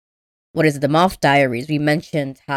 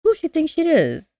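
mock jealous, pitch leaping up mid-rant. Janet sees another woman near her man, jealous. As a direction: act out a jealous woman's indignation. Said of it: "gets all, like, jealous".

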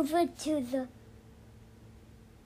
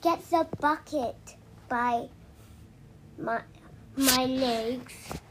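goat voice. Acted. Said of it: "The goat says".

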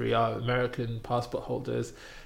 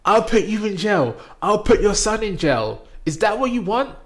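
much higher, louder furious threat; menacing threats; 9 semitones higher, 6 dB louder than usual. Retold or imitated. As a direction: threaten her like a bully. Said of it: "He's like".

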